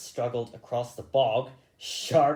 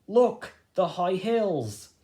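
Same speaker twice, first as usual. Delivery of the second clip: excited; 7 semitones higher, 5 dB louder, slower than usual. Acted. Hazel sees the hills.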